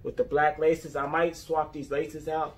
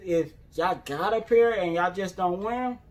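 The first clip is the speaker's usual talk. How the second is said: normal talking voice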